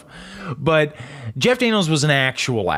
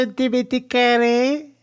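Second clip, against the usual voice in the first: put-on weird New Jersey accent; 10 semitones higher than usual. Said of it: "that's a weird accent".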